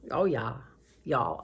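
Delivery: "Oh, yeah" drawled low in a light Southern accent; playful Southern accent. Shown as a demonstration. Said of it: "Anyway, I love accents".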